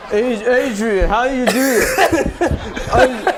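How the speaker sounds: Sylvester Stallone. Pretend. Player as a film star struggling to learn sign language, hammed-up impression. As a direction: drag the words out like a baffled boxer. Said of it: "Stallone learning sign language".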